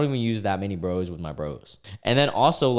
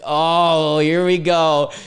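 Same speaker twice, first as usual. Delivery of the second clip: loud, raised "here we go again" reaction; exasperated exclamation; 7 semitones higher, 7 dB louder, much slower than usual. Real, not acted. Host spotting the predictable insult, loudly exasperated. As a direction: call it out high and loud.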